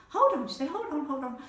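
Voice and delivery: high, loud cut-in; urgent interruption. Acted. Spider stops doubting animals, urgently interrupting. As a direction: jump in to halt the doubters.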